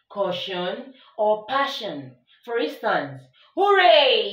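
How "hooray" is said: high, loud "Hooray!"; excited cheer. Shown as a demonstration. Teacher shows strong emotion with a loud cheer. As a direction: cheer it out like you just won. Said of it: "that actually denotes strong emotion".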